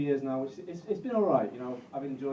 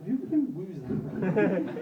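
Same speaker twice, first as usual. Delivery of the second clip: slow mocking hoot; 5 semitones higher, 4 dB louder, much slower than usual. Mock hooting at crowd whoop.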